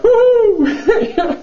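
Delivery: high, loud whooping taunt; playful hoot. Retold or imitated. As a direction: whoop it out teasingly, far above your voice. Playful taunting hoot while getting away.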